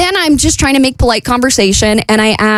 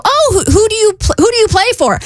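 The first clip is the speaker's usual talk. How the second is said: much higher, eager little squeal of a question; 10 semitones higher than usual. Retold or imitated. Asking the athlete which team he plays for, eager and high. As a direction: ask like a starstruck fan.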